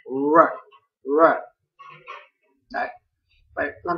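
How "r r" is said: R said loud with a curled tongue; loud demo of the R; R sound demo. Shown as a demonstration. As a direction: isolate the American R. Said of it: "Đó chính là âm R".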